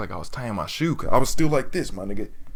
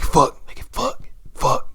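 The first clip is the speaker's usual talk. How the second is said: panicked inner curse; 7 semitones higher, 5 dB louder, slower than usual. Retold or imitated. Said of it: "I'm like".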